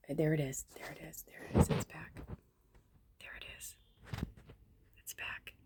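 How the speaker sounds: hushed, breathless whisper; real whisper. Real, not acted. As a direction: whisper it as if not to scare it off. Whispering as something reappears.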